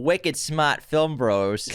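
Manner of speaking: slow Boston drawl on wicked smart; Boston accent. Pretend. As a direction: read it like a Boston guy. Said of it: "do this in a Boston accent".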